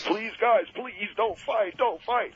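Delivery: high, slow begging on the verge of tears; tearful plea. Retold or imitated. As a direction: plead through tears, stretched out. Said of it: "he's crying".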